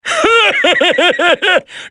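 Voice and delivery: high, gleeful villain cackle; maniacal laugh. Acted. Villain cackling at his prey. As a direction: cackle like a madman on the hunt. Villain taunts hiding soldier.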